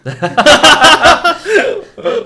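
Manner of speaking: loud, high-pitched cackling laugh; burst of laughter. Real, not acted. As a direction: crack up loudly and helplessly. Reacts to suddenly remembered story.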